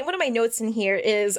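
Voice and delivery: normal talking voice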